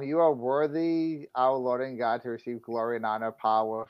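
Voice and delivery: hushed, measured recitation; scripture reading. Acted. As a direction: read the verse softly and solemnly. Reads Bible verse aloud.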